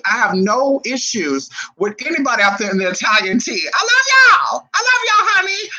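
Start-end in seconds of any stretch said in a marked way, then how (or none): 3.7-5.7 high, loud cry of love; squealing affection, much higher; gushing excitement. Real, not acted. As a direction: shout love at the audience. Gushing love to Italian viewers.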